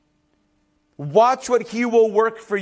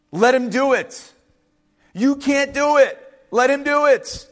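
pitched high, insistent preaching; emphatic urging; 6 semitones higher, 4 dB louder than usual. Acted. Moses urging people to let God fight, insistently. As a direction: drive each short phrase home, high and firm.

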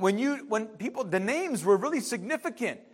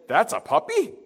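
scoffing brother; 3 semitones higher than usual. Retold or imitated. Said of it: "And Noah's like".

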